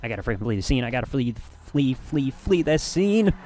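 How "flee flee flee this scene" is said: slow, hammed-up theatrical delivery; mock dramatic drawl. Streamer joking about fleeing the crime scene, slow mock drama. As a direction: stretch it out like a movie villain escaping.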